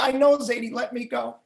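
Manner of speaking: put-on high-pitched squirming kid; protesting grandchild. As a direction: plead to be let go. Child squirming in grandfather's hold.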